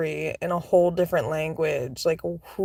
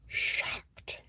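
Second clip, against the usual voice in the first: "Shocked" whispered unvoiced for effect; 11 dB quieter than usual. Shown as a demonstration. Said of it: "Absolutely shocked".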